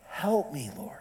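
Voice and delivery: scared whispered plea to God. Retold or imitated. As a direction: whisper it like a desperate prayer. Frightened prayer before interrogation.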